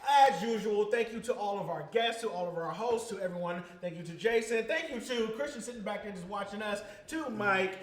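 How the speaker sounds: loud upbeat announcer outro; show host sign-off. Acted. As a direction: wrap up the show with big energy.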